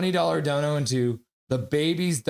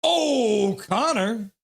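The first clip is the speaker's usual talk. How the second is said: long, high, loud "oh" falling into "Connor"; playful drawn-out holler; acted fan cheer; 8 semitones higher, 4 dB louder, much slower than usual. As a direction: belt the name out like a stadium fan. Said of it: "Benny Song's like".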